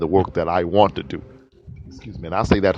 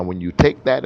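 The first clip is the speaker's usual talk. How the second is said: ordinary talk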